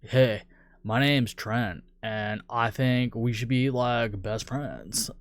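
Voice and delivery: frat bro. Retold or imitated. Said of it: "it sounds like a frat boy".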